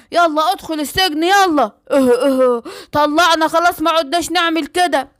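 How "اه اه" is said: 2 long, drawn-out crying groans; 3 moan as if beaten down; 4 defeated villain groaning slowly; 1 slow pained groans. Acted.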